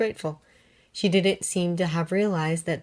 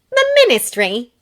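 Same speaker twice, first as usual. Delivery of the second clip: high, louder scoffing question; 10 semitones higher, 9 dB louder than usual. Acted. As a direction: sneer at the idea. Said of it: "scoffed Hermione".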